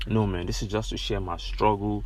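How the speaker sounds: ordinary delivery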